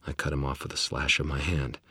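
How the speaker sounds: ordinary delivery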